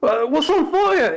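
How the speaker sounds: very high, loud, puzzled voice; high Lionel voice. A narrator's reading. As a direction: sound confused from far above. Lionel answers from tower.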